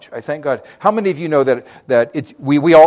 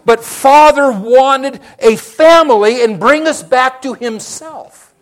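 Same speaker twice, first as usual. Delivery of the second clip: rising, louder preacher's build; preaching at full force; 7 semitones higher, 9 dB louder than usual. Acted. Preacher rouses the congregation, loud and high. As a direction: drive the point home to the church.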